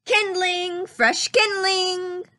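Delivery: high, slow vendor's call as Mickey; calling out wares. Acted. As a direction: sing out the firewood for sale. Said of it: "called Mickey".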